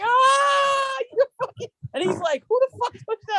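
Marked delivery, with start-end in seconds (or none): 0.0-1.7 group yell. Retold or imitated. Everybody bursts out yelling. 2.5-3.4 outraged disbelief. Retold or imitated. Friend outraged at the reveal.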